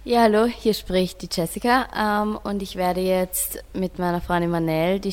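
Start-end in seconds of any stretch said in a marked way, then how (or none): none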